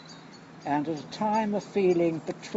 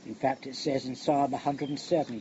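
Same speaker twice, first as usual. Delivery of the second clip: usual speaking voice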